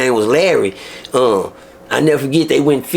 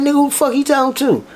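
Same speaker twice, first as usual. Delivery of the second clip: indignant; 10 semitones higher than usual. Retold or imitated. His indignant inner reaction.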